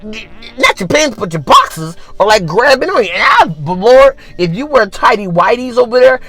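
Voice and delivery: ordinary talk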